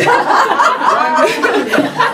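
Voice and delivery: high-pitched burst of laughter; real laughter. Real, not acted. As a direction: crack up mid-story. Cracking up at own story.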